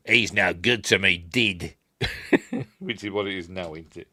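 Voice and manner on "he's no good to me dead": mock New Zealand accent, vowels shifted; New Zealand accent with "dead" turned into "did". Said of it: "don't say it in a New Zealand accent".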